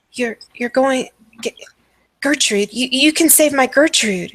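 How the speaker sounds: lightly voiced madwoman, stammering with hope; a touch of desperate, halting hope. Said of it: "she's like".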